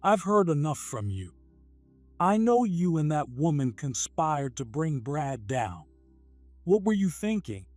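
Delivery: an understated furious reprimand; harsh shout. Acted. Furious president confronts the plotting employee, mildly harsh. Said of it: "shouted in a harsh tone".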